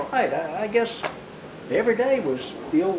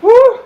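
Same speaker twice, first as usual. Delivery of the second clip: loud whoop; 13 dB louder than usual. Real, not acted. Exclaiming about chaos.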